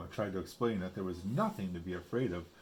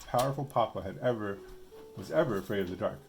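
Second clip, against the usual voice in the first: ordinary delivery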